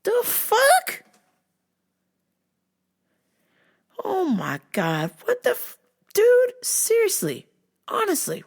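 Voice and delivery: high, squeaky disbelief; incredulous outrage. Retold or imitated. Reacting to coworker's scary remark, high shocked outrage. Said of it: "And I was like".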